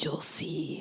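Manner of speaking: hushed, teasing whisper; secretive whisper. Retold or imitated. Telling her sister to wait and see, whispered.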